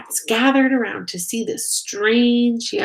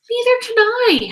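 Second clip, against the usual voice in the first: very high, loud panicked howl; 7 dB louder than usual. A narrator's reading. Flitter howls to Pip in the dark, panicked. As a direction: howl it in fright. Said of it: "howled Flitter".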